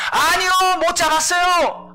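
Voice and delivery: high, loud, slow reply across the water; shouting back. Acted. Disciples shout back from boat. (배에서 크게 외쳐 대답하는 목소리)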